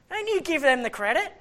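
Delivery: high, loud mock telling-off; jealous dad. Dad to his kids about credit, jealous scolding. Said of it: "and I'm jealous".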